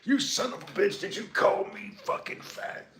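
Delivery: high, strained mock-furious celebrity rant; Joe Rogan impression. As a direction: squeal it like an offended tough guy. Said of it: "I'm surprised he doesn't show up in here".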